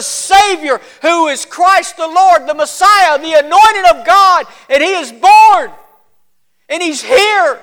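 impassioned witness, slowing on the climactic phrases; fervent shepherd. Acted. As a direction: declare the good news with total conviction. Shepherd proclaims the Savior's birth.

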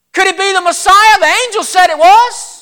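high, loud, wondering question; amazed shepherd. Acted. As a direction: burst out with incredible hope. Shepherd amazed at the Messiah news.

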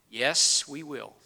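ordinary talk

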